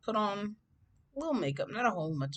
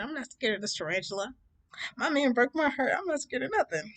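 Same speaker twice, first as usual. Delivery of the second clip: super sassy; 7 semitones higher than usual. Retold or imitated. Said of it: "I was being super sassy".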